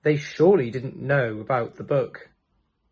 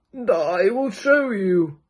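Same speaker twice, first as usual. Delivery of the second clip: put-on high, slow old man; 5 semitones higher, slower than usual. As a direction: beckon like an old sage. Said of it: "said the old man".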